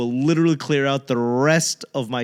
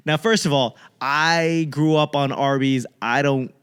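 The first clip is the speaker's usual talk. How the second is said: usual speaking voice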